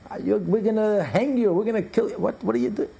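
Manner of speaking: high-pitched, blustering tirade; outraged yelling. Acted. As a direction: yell threats like an incensed commander. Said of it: "he starts yelling".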